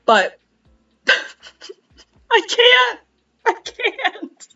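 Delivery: high, very slow, laugh-cracked; giggling, dragging out can't; laughing helplessly. Real, not acted. Admitting she can't reread the book, laughing helplessly. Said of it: "I'm laughing because it's funny".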